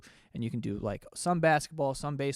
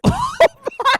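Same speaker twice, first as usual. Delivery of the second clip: a sudden high-pitched burst of laughter, loud; high shrieking laugh; 12 dB louder than usual. Real, not acted. Laughing out loud at the aunt text, high shriek.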